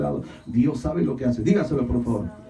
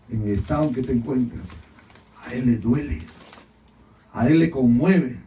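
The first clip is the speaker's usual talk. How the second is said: ordinary talk, nothing marked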